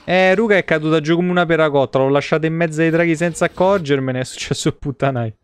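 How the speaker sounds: ordinary delivery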